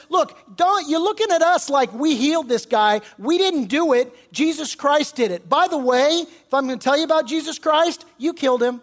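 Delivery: loud, high-pitched confrontational preaching; Peter preaching. Acted. Preacher as apostle addressing the temple crowd, loud accusation. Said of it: "so he starts preaching".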